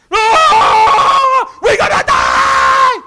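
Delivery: high, loud, slowed terrified yell; panicked passenger. Retold or imitated. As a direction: scream it like bullets are flying. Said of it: "one of our passengers".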